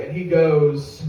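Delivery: ordinary talk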